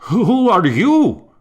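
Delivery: high, loud, slow startled demand; startled question. Acted. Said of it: "startled enough to speak against the vow".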